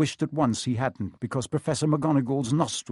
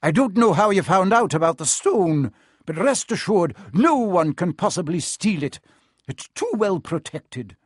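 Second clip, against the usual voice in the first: louder, higher-pitched, emphatic assurance; stern professor; 6 semitones higher, 5 dB louder than usual. Acted. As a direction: brook no doubt. McGonagall reassures sternly.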